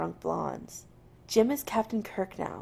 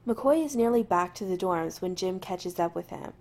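ordinary delivery

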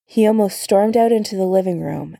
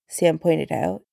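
usual speaking voice

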